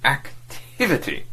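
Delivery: Activity drawn out slowly, pressed home; slow, deliberate repeat of "Activity"; slow emphatic repeat. Acted. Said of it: "On repeating this last word".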